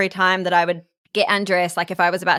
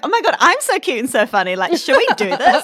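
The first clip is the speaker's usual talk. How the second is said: higher-pitched, giggly self-flattery; mock giddy self-admiration; 7 semitones higher than usual. Joking with partner about being irresistible, mock giddy. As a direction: gush playfully over yourself, pitched up into a laugh. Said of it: "And I'm like".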